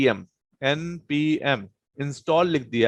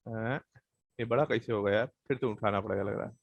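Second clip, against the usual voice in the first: low, much softer aside to himself; muttering to himself; 4 semitones lower, 8 dB quieter, faster than usual. Real, not acted. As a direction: mutter under your breath while puzzling it out. Fiddling with the picture, talking to himself. (अपने आप से धीमे स्वर में बुदबुदाना)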